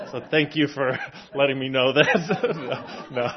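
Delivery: amused, chuckling at his own mistake; laughing. Real, not acted. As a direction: laugh through it at yourself. Laughs at own failed cleverness.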